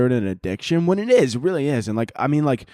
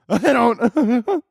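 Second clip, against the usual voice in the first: normal talking voice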